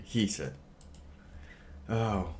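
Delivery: usual speaking voice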